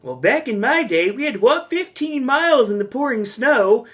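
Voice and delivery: put-on high-pitched wise old grandpa; old man voice. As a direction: play the rambling old grandpa. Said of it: "there's always a very wise old man, and he goes".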